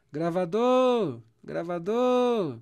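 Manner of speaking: slow, loud call of "Gravador!" with the weight on "dor". Shown as a demonstration. Teacher calls out the word to show its stressed syllable, loud and slow. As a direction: call "Gravador!" across the room, stretching "dor". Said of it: "é só você chamar essa palavra".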